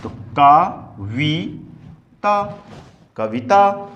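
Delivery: Kavita stretched syllable by syllable; slowed sounding out. Shown as a demonstration. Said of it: "धीरे से बोल रहे हैं". (कविता को धीरे-धीरे खींचकर बोलें)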